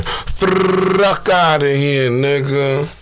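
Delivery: catchphrase stretched out very slowly; Smack. Retold or imitated. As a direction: drag out "Fuck outta here" in imitation. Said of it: "In the smack version".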